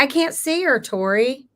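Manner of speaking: ordinary talk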